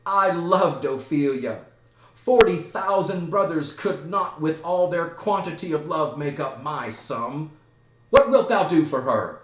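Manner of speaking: loud, high theatrical outburst; impassioned declaration. Acted. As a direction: proclaim love as a challenge. Hamlet declares love, challenges Laertes.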